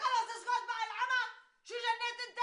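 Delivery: loud, high-pitched marital outburst; exasperated yell to shut up; angry shouting. Acted. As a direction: shout him down, voice pushed up high. Wife quarrels with husband, tells him quiet.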